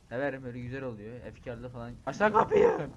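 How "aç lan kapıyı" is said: loud, much louder bark; pound-on-the-door shout. Real, not acted. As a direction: yell at whoever is behind the door. Yelling at a door in the game.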